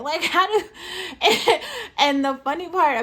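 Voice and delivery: high burst of laughter; laughing. Real, not acted. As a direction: laugh through the words. Laughs at weird story.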